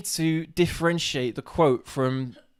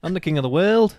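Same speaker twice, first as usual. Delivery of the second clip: higher, louder Australian drifting towards Scouse; attempted Australian accent; 4 semitones higher, 6 dB louder than usual. Player retrying the film quote for a friend, badly faked Australian accent. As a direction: proclaim it in a bad Australian accent. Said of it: "is that how Australians say world".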